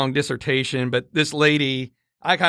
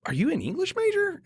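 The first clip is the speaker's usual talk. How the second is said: pitched way up, playing dumb; high mock-innocent question; 5 dB quieter than usual. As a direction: ask it high and wide-eyed. Naive quip, high voice.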